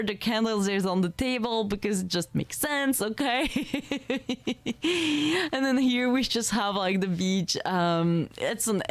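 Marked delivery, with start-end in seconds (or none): 3.5-5.5 giggle. Real, not acted. Explaining the cake on the floor to viewers, a little giggle. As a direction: giggle at your own silly logic. Laughing at her own joke.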